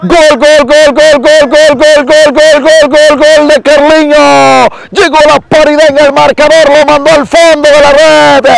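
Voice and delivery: long high goal shout; breakneck goal commentary. Real, not acted. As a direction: scream the goal call, stretching it. Goal scored, extended goal cry.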